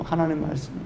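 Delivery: ordinary delivery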